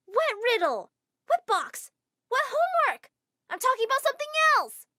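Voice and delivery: very high, loud frustrated yells; exasperated shouting. Acted. Girl yelling in frustration at the clueless teacher. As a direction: shout in disbelief.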